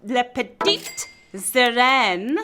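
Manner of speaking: slow, drawn-out French accent. Shown as a demonstration. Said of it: "show off my French accent".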